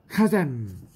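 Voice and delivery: loud, grand spell call; magic word. Acted. Sorceress Wanda casts her spell, a loud proclaiming call. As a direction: fling the spell out with a flourish.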